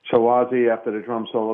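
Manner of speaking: usual speaking voice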